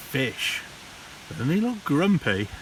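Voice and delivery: ordinary delivery, nothing marked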